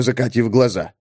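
ordinary delivery, nothing marked

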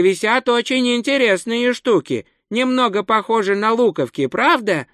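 высокий голос наивного туриста; говори заметно выше, простодушно и старательно; простодушный турист. A narrator's reading. Простодушный турист подаёт голос в рассказе. (высоким голосом наивного туриста)

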